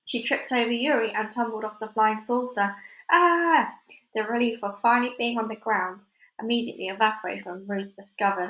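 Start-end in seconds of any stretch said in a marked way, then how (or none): 3.1-3.7 high loud yelp while tumbling; startled yelp of a fall. A narrator's reading. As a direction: cry out as she falls. Girl tumbles off the saucer.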